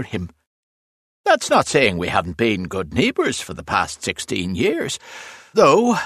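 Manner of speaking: high, lively, grumbling character voice with big swoops; roadworker Broderick, grumbling. A narrator's reading. Roadworker complains to his mate about the gravedigger, animatedly grumbling. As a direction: gripe with relish about a stubborn neighbour.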